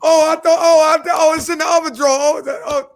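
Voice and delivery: high, hurried caught-out stammer; flustered wife. Retold or imitated. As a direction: play the wife caught hiding it.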